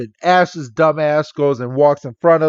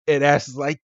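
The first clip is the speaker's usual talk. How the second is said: usual speaking voice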